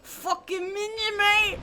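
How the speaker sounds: put-on British accent. Picturing British stoners for chat, exaggerated British accent. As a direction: caricature a British bloke, stretching the words. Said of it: "I could picture British people smoking weed".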